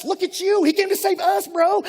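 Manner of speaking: high and loud, buzzing with joy; excited. Retold or imitated. Believer thrilled that Jesus saved us, high excited cheer. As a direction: cheer it like a friend hyped up. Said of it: "we get all excited".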